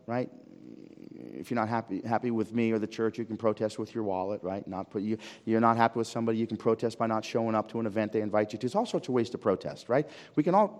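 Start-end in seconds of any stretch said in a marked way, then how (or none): none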